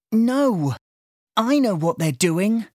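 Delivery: put-on girl's indignant outburst; angrily. A narrator's reading. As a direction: cut him off, angry. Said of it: "said April angrily".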